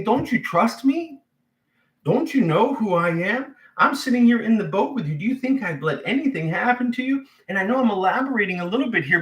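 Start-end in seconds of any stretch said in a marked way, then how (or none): 0.0-7.2 much higher, hurt and insistent; pleading rebuke. Retold or imitated. Jesus looking at his disciples, high pleading rebuke. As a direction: press them to trust him. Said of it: "he's saying".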